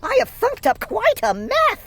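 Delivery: high dismayed voice; a girl realizing her mistake. A narrator's reading. Sister dismayed at her thunked glunk, high voice. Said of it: "gasped my sister".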